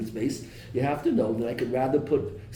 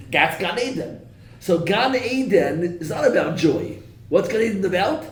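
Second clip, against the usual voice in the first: rising passion; 6 semitones higher, 6 dB louder than usual. Real, not acted. Building rhetorical climax.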